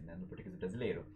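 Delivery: ordinary delivery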